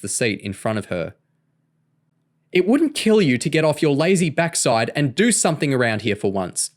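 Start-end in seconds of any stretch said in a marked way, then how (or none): 2.5-6.7 yelling. A narrator's reading. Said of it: "Patricia yelled".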